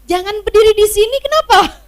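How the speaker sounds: loud, high, irritated snap at husband; mock-angry wife chewing out her husband; annoyed wife. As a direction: scold him for standing in the way. Wife scolding husband who bumped her.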